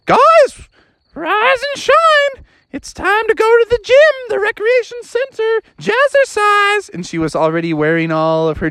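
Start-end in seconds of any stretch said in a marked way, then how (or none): 0.1-6.9 much higher, sing-song morning call; cheery wake-up. Acted. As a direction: rouse everyone brightly. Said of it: "woke everybody up and said".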